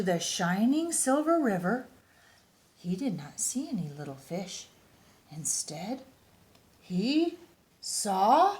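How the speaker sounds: lively picture-book read-aloud; reading to children. A narrator's reading. Reading a bear picture book to young listeners, animated storytime voice. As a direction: read it like storytime for little kids.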